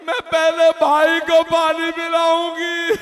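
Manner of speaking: high, loud, slow lament; drawn-out tearful cry; child's wailing vow. Acted. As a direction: wail it out as a grieving little girl.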